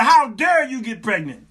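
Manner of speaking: loud, high retold scolding; comic indignant shout at the wife; mock outrage. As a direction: re-enact a husband's indignant accusation.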